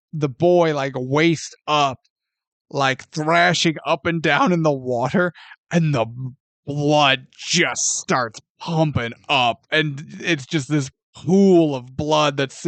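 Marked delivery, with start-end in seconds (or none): none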